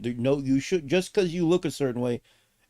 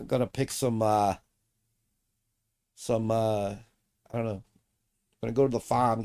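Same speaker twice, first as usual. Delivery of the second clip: ordinary talk, nothing marked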